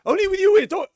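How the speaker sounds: high, loud mock outburst of a client; shouted quote. Therapist imitating an agitated client in his room, high and loud. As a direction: blurt it out at the top of the voice. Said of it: "when they say like this".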